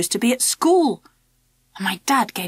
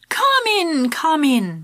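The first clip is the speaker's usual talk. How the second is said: cheerful; 5 semitones higher, slower than usual. A narrator's reading. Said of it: "my dad interrupted cheerfully".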